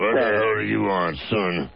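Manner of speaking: gruff put-on voice of an annoyed old man; grumpy growl at a late-night caller; mad old man. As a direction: play the old man woken up angry. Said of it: "he's, he's mad, upset".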